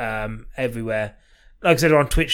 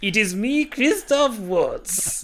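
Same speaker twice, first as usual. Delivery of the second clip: high, drawn-out impression of an Austrian actor; Christoph Waltz; 8 semitones higher, much slower than usual. Podcast host signing off as a film star, high impression. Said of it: "a good Christoph Waltz impression".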